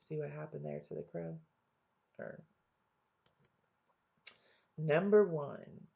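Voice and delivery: ordinary talk, nothing marked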